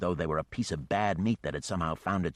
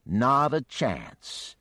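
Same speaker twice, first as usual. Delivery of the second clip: slow firm refusal; 6 semitones higher, 4 dB louder, much slower than usual. Acted. Geneva refuses again.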